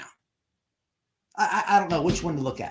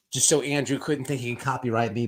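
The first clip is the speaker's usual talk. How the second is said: ordinary talk, nothing marked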